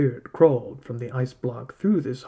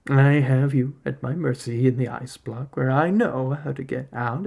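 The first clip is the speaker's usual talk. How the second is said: ordinary delivery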